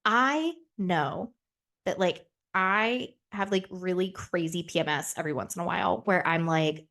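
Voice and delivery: normal talking voice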